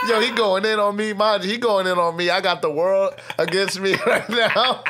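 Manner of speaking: high, laughing through the words; chuckling at his own bad luck. Real, not acted. Telling his friend the story while laughing.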